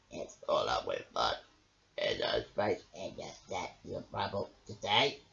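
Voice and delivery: low, croaky toad voice; a gloomy toad asking for a bed; grumbling toad. A narrator's reading. Toad asks Bramble for space, low grumble.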